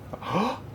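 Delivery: quick high gasp; imitated sharp gasp. Demonstrating the body rise.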